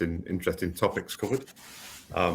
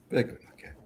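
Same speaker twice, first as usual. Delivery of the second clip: whispered aside; faster than usual. Shown as a demonstration. Repeated as a whisper.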